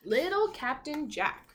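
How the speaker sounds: ordinary delivery, nothing marked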